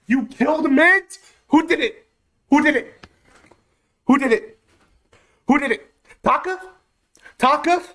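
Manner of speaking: real outrage; angry disbelief. Real, not acted. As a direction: interrogate the killer. Demanding who killed.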